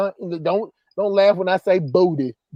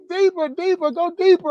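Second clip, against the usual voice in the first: mock woman begging; 8 semitones higher than usual. Retelling his partner in bed asking for more, high mock plea. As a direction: ham it up, pitched way up like her. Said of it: "she like".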